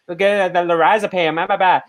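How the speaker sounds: imitating a bad Boston accent with slurred R's; lightly mocked, slurry Boston accent. Critic mimics actress's bad accent, slurred R's in mock Boston. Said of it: "when she slurs her R's and says".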